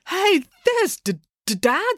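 high, drawn-out, stammering surprise; stunned stammer. A narrator's reading. Child spotting Dad on stage, stunned stammer. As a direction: stumble over the word in astonishment.

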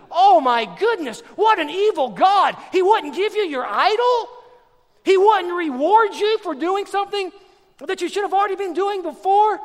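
high, loud, incredulous sarcasm; mock outrage. Preacher mocking complaints against God, mock outrage. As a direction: pile on the sarcastic indignation.

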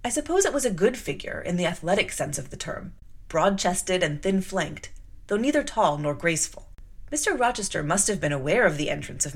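measured novel reading to a class; story narration. A narrator's reading. Teacher reading Jane Eyre to students, steady narration. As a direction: read the passage steadily, like a storyteller.

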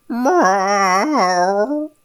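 loud high drawn-out cat meow; terrible meow. A narrator's reading. Unhappy cat heard through the neighbor's door, loud high meow. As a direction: yowl like a miserable cat. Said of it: "meow sounds of a very unhappy cat".

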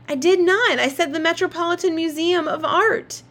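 yelling. Acted. Claudia yells denial.

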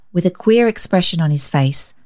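usual speaking voice

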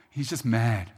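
ordinary talk

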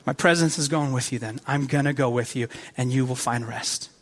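low, soft and soothing; calming reassurance. Acted. God answering a freaking-out Moses, low calm reassurance. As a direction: settle down someone who is panicking, quietly. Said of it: "God is saying".

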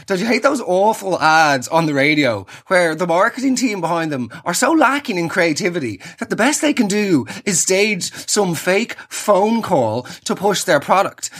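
usual speaking voice

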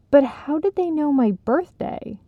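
sharp, suspicious question; pointed accusation. Acted. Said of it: "Janella asked pointedly".

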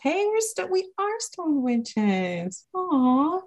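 high, slow spooky stoner voice. Pretend. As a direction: drawl it out like a stoned witch. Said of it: "playing stoned witch intro".

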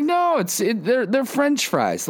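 very high mock mom voice; insistent mom. Mom denying to her kid that it's liver, high and insistent.

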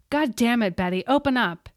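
loud furious yell; bang-on-the-door rage; angry shout. A narrator's reading. Pounding on door, furious teacher.

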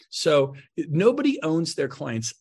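usual speaking voice

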